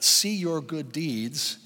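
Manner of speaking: "See your good deeds" drawn out slowly; slow emphasis. Shown as a demonstration. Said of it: "Circle that".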